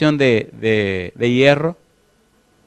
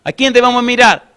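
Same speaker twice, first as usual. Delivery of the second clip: higher and louder, a rousing question; calling out to congregation; 7 semitones higher, 5 dB louder than usual. Real, not acted. Pastor rouses the church to answer, loud call. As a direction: fire the question out at the pews.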